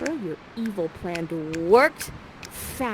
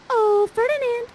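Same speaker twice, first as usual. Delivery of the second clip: much higher, slow sing-song flirting; flirty call; 10 semitones higher, 8 dB louder, slower than usual. Acted. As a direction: coo at him to catch his eye. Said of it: "And Miranda's like".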